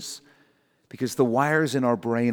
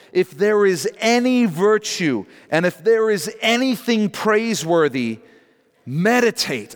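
scripture reading; 4 semitones higher than usual. Acted.

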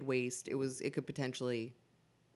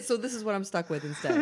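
Peter Griffin style wheezy laugh; laughing through pain; 9 semitones higher, 5 dB louder than usual. Real, not acted. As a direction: laugh hard while it hurts. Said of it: "the surgery has given him the Peter Griffin laugh".

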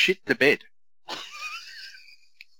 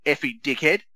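lightly voiced small child parroting the swear; a hint of a tiny kid repeating dad from the back seat; little kid voice; 4 semitones higher, 4 dB louder than usual. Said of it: "this little voice in the back".

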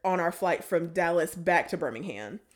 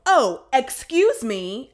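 loud, drawn-out mock excuse-me; dramatic; 4 semitones higher, 7 dB louder, much slower than usual. To someone silently scooting by, dramatic. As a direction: make a big deal of it, slow and pointed. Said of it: "dramatically, like, move my butt".

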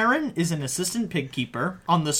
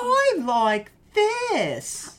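cartoon pig voice; 14 semitones higher, much slower than usual. Voicing a pig character.

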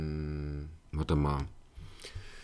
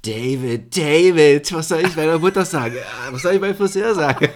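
movie quote voice; 9 semitones higher, 13 dB louder than usual. Retold or imitated. Quotes Independence Day movie line.

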